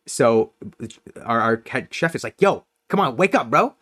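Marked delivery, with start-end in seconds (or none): 2.4-3.7 higher-pitched retold wake-up call; calling to wake someone. Head chef to a passed-out customer at closing, calling out. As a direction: imitate the chef rousing a sleeper.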